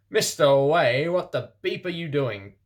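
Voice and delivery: loud, angry teacher yelling; furious professor shouting at Ebony. A narrator's reading. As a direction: bark it at the student. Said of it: "Rumbridge shouted angrily".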